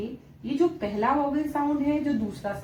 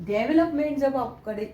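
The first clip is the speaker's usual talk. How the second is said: ordinary talk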